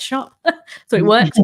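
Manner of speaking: a real amused chuckle carrying the line; laughing. Real, not acted. Host laughing at her own Boots anecdote, amused.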